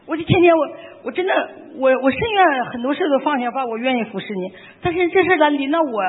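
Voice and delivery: indignant protest to Father God, voice pushed up; half-acted aggrieved outburst; angry at God. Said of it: "我当时跟天父爸爸非常生气".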